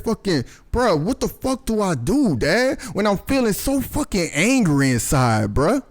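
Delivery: high, pleading imitation of an upset son; retold near-tearful plea. Retelling son asking Dad about his anger, mock near-tearful. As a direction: act out an anguished appeal, no real tears. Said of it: "seemed like he was about to cry".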